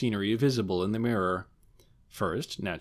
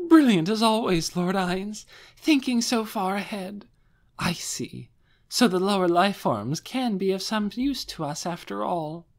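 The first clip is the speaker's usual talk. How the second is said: higher, louder, adoring woman's voice; adoring high praise; 10 semitones higher, 3 dB louder than usual. Acted. Albedo praising Lord Ainz, higher and adoring. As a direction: swoon with delighted praise.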